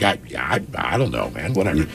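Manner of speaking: low, quiet stoned mumble; lazy stoned mumble; mumbling stoned. Retold or imitated. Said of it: "and I'm like".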